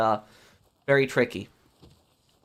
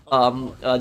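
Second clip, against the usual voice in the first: usual speaking voice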